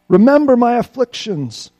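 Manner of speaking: crying out. Acted. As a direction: plead with God. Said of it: "he cries".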